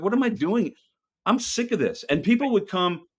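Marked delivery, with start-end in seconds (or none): 0.0-2.0 high, fed-up retelling of his own outburst; exasperated self-quote. Retold or imitated. Retelling his outburst to friends, high and fed up. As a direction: toss it up high, sick of it all. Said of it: "And I was like".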